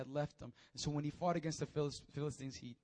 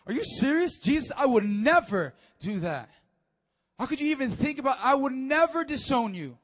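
high, loud indignant denial; offended protest; 8 semitones higher, 13 dB louder than usual. Acted. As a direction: protest like you're insulted. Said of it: "he got offended".

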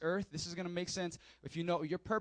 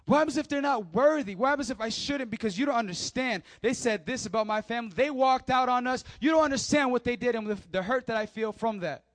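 higher and louder, wounded and pleading; hurt protest; 3 semitones higher, 7 dB louder than usual. Retold or imitated. Hurt listener protesting about family betrayal, loud and wounded. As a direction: pour out the grievance like a hurt church member. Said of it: "voicing hurt listener's protests".

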